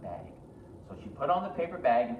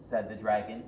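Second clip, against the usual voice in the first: usual speaking voice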